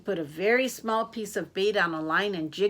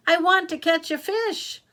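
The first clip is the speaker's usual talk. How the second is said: put-on high, loud childlike demand; impatient child; 10 semitones higher, 7 dB louder than usual. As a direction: whine it out, impatient for a catch. Impatient girl demands a fish.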